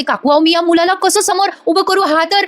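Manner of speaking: lightly voiced young wife, near tears; pleading daughter-in-law. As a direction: beg her mother-in-law not to press her. Kavya pleading with mother-in-law.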